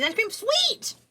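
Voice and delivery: sudden high-pitched cheer; excited. Real, not acted. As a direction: burst out delighted at a little prize. Spots a reward mid-stream.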